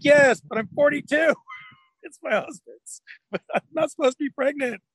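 high-pitched, hammed-up tearful wail; mock crying. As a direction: imitate a woman breaking down in tears, half laughing. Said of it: "and she starts crying, breaking down".

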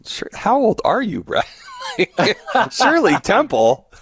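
high-pitched, teasing astonishment breaking into laughter; laughing disbelief. Real, not acted. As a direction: rib a friend, incredulous and cracking up. Teasing guest about his dated crush.

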